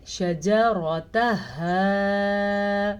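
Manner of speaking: slow recitation; throat ha. Shown as a demonstration. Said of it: "کیسے".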